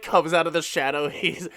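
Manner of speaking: ordinary talk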